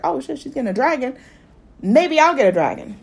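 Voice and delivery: perking up. Retold or imitated. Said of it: "kinda perk up 'cause she's like".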